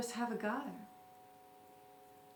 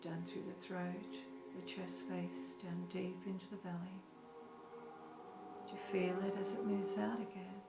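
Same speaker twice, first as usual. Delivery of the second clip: guided meditation; 7 dB quieter than usual. Acted. Teacher leading listeners through a short breathing meditation, calm guided meditation. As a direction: gently guide them into stillness, unhurried.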